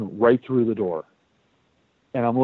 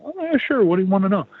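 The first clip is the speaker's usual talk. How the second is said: mock high voice of a cheerful coworker; high squeaky nurse voice; 9 semitones higher, faster than usual. Retelling the barn nurse's cheerful answer, in a mock high voice.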